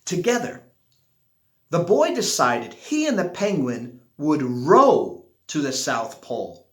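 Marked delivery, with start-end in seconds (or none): none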